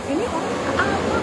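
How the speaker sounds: shocked disbelief. Retold or imitated. Woman reacting to her boyfriend's behaviour, appalled. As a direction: sound appalled at what he's doing.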